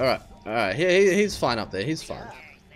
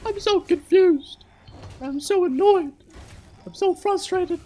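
high squeaky disbelief, louder; stunned outrage; 3 dB louder than usual. Real, not acted. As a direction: shriek your confusion at the screen. Gamer losing it at bug.

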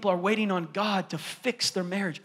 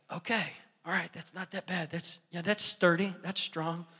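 quiet relief; 8 dB quieter than usual. Retold or imitated. Son calming, testing rock.

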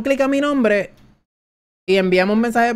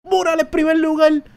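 higher, a bit louder winner call; excited announcement; 7 semitones higher, 3 dB louder than usual. Real, not acted. Streamer to chat announcing the winner, excited. Race result called out.

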